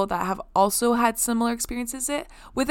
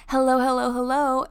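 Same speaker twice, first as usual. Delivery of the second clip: imitating fiance's phone greeting; 4 semitones higher than usual. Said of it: "now answers the phone like".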